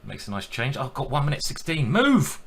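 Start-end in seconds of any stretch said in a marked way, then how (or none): 1.9-2.4 sudden high, loud yelp; urgent cry. Real, not acted. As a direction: blurt it out high against the clock. Urging himself as clock runs down.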